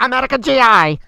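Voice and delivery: loud, high wartime radio call; old radio voice. Retold or imitated. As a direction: announce like a WWII radio broadcast. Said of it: "old propaganda radio in World War Two".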